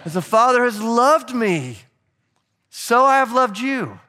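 loud slow shouted call for the crowd to join; full-voiced shout, high and loud. Real, not acted. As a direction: belt it so the whole room joins in. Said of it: "Help me out".

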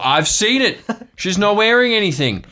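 an uncle huffing about a mermaid; scandalised uncle. Acted. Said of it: "It's like".

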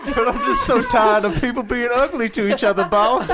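Michael Clarke Duncan. Acted. Weary man pleads with his boss, hammed-up impression. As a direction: plead it as a hammed-up movie line. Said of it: "brought to you by Michael Clark Duncan".

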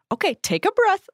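high, patronising sing-song calm-down; mock-soothing condescension; condescending. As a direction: talk down to them like a child. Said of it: "without being condescending".

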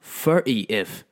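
"thirtieth" said as fir'y-if; mocking "thirtieth", th turned to f. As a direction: mimic Cockney "thirtieth" with f sounds. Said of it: "so many F's in that".

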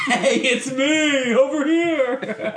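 very high, slow, loud laughing call; high-pitched calling out. Pretend. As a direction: wave someone down, squealing and laughing. Mock calling out to celebrity.

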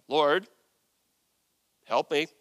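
measured, insistent plea in separated pieces; firm demand. Shown as a demonstration. Woman laying down the law to Jesus, firm. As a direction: lay it down firmly, pausing after Lord. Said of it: "I wonder how firm I should be reading that".

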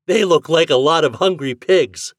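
usual speaking voice

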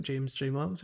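ordinary talk

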